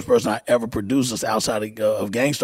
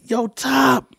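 much higher, louder call down the stairs; yelling down; 13 semitones higher, 4 dB louder than usual. Retold or imitated. Calling his partner up from the basement, loud retold yell. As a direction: shout for your partner below. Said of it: "yelling down".

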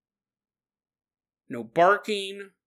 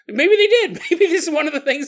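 laughing; 11 semitones higher, 7 dB louder than usual. Real, not acted. Laughing at own joke.

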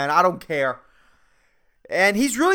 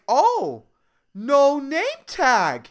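put-on high, slow, childlike singsong; creepy customer voice; 5 semitones higher, much slower than usual. Customer questioning barista's name tag, creepy high voice. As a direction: draw it out in a creepy sweet lilt. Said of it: "he talks like this".

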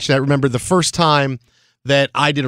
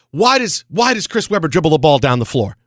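animated; 7 semitones higher than usual. Retold or imitated. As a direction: get fired up arguing the play. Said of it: "he's getting really animated".

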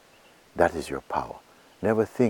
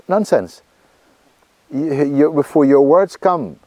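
loud burst; 9 semitones higher, 9 dB louder than usual. Real, not acted. Loud burst after the whisper.

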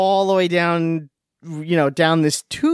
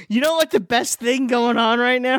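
mock enthusiastic quote; 7 semitones higher than usual. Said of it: "I don't remember going".

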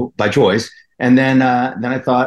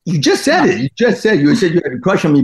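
higher, mock-offended New Jersey accent; Jersey accent kept up; 6 semitones higher than usual. Teasing the host who took back her crush, in a higher New Jersey accent.

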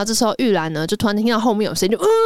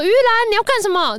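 a high, louder call to stop her; 12 semitones higher, 4 dB louder than usual. Retold or imitated. Retelling a drama scene, a high alarmed call across the water. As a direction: sixth prince calls out to Yulan across the lake, loud and high. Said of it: "六爷就叫住他说".

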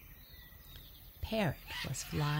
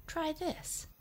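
monkey voice; 12 semitones higher than usual. Acted. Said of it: "said Monkey".

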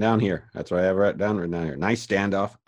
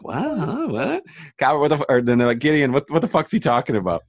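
confused crew; 7 semitones higher than usual. Retold or imitated.

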